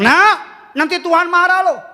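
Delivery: parent scolding. Retold or imitated. Parents threatening their restless child, high mock scold. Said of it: "Papa mamanya bilang".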